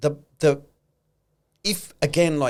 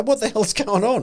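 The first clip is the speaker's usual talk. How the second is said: higher, startled exclamation as the stock tanks; mock shock; 8 semitones higher than usual. Investor reacting to a thirty percent loss, higher startled disbelief. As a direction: play the stunned investor's outburst.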